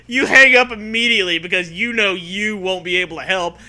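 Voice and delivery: ordinary talk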